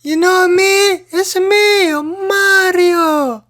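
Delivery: very high, loud, slow cartoon voice; Mario impression. Game guy revealing himself to the hero, loud high Mario impression. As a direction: squeal it and stretch out the final name. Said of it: "the guy goes".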